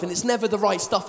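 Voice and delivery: ordinary talk, nothing marked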